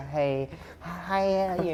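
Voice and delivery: high, lightly mocked celebrity greeting; goofy comedian imitation; Adam Sandler. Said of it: "my terrible Adam Sandler impression".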